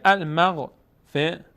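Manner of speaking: المغفرة slowly, ending in -ratu; continued reading with damma. Shown as a demonstration. Teacher introduces the example word, full -tu ending. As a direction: sound te with short u closing المغفرة. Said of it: "اگر روش توقف بکنیم".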